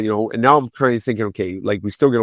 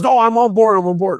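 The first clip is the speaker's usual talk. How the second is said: eager agreement; 9 semitones higher, 5 dB louder than usual. Retold or imitated. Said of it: "he goes".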